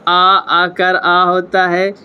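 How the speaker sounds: आ drawn out long and open, word by word; slow sounded aa. Shown as a demonstration. Teacher slowly modelling the aa vowel. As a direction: stretch each आ slowly for the class. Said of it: "Hindi में आ होता है".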